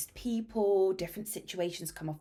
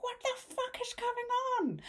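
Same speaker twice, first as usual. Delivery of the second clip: much higher, incredulous cry; high-pitched shocked disbelief; shocked outburst. Retold or imitated. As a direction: squeal it in disbelief. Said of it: "I was like".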